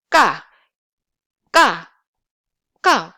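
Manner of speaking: "ga" loud and short, air built up first; hold, then release a tight, forceful "ga"; tense k. Shown as a demonstration. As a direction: pop out "ga" hard with no puff. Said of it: "build the air before pronouncing".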